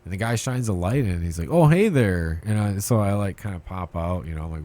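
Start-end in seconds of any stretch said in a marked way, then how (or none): 1.5-2.4 higher, louder mock greeting; imitated greeting. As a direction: call out like someone just found you. Guy with light finds them.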